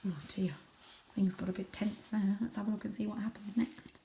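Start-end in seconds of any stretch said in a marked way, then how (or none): none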